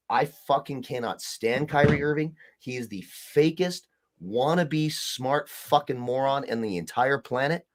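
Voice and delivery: ordinary talk, nothing marked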